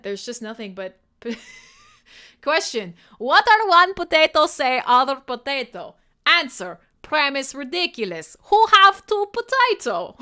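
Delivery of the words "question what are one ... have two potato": loud, hammed-up Eastern European accent. Host reads a chat meme aloud in a loud Eastern European accent. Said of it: "read my memes with the Eastern European accent".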